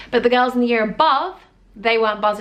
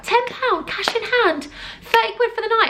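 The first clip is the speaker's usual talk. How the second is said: high-pitched thrilled quote of her younger self; excited disbelief; 11 semitones higher than usual. Retold or imitated. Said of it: "we were really excited".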